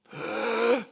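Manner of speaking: high, sharp demo gasp; shocked gasp. Shown as a demonstration. Showing the gasp to avoid, a mock shocked gasp. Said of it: "try not to gasp".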